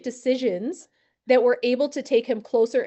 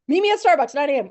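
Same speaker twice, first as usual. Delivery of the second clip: mock casual invite; 3 semitones higher, 5 dB louder, faster than usual. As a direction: replay the throwaway invite with a laugh in it. Re-enacting his own casual invite.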